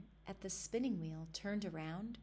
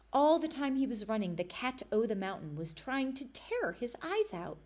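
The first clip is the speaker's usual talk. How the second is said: ordinary delivery, nothing marked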